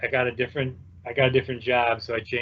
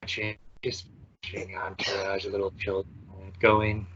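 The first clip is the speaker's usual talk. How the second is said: hushed golf commentator, quiet and low; golf announcer voice; 4 semitones lower, 6 dB quieter than usual. Pretend. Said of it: "practicing your golf announcer voice".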